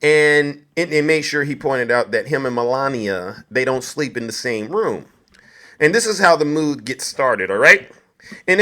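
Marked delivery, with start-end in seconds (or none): none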